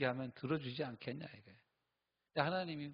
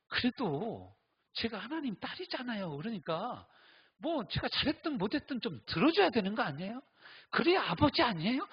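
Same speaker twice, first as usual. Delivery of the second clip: higher, coaxing plea to a father; pleading daughter's prayer; 9 semitones higher, 3 dB louder than usual. Retold or imitated. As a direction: whine to God like a spoiled daughter. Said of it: "뭐라고 기도하는지 아세요". (아빠에게 조르듯 높은 목소리로)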